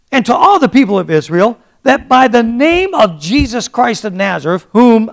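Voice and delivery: loud, high-pitched scripture proclamation; full-force preaching. Acted. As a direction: proclaim the verse boldly to the whole room.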